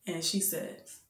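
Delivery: ordinary talk, nothing marked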